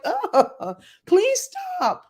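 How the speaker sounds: high, laughing mock exasperation; laughing plea. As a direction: beg them to stop through a laugh. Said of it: "I'm like".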